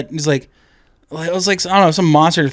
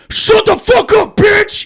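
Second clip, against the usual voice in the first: very high, drawn-out mock shout; imitated angry heckler; 3 dB louder, slower than usual. Older moviegoer to a yapping kid, imitated angry shout. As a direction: yell it like a fed-up moviegoer from the back. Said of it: "somebody who's obviously older go".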